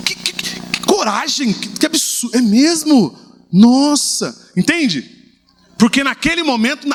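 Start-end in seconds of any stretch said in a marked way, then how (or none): 0.1-2.4 stunned stammer building higher; mock indignant sputter; stammering outrage. Shown as a demonstration. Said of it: "umas caras assim". 2.4-4.5 louder surprised exclamation; hammed-up sympathetic wow; mock amazement. Shown as a demonstration. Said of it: "umas caras assim".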